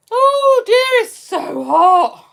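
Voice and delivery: high, loud wail, drawn out at the end; loud heat complaint. Real, not acted. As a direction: moan loudly about the heat. Complains loudly about the heat.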